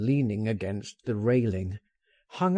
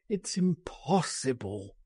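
groaning; 4 dB quieter than usual. A narrator's reading. Oscar gives in to despair before Frank, groaning. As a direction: groan it out in defeat. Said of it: "he groaned".

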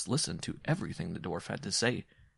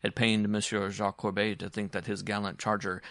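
ordinary delivery

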